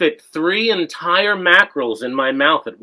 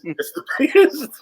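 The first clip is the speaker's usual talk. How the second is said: mock Trump; 9 semitones higher than usual. Shown as a demonstration. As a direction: impersonate a boastful politician.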